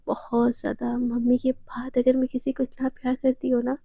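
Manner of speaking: soft, low declaration of love; tender confession. Acted. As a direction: confide it gently to your beloved.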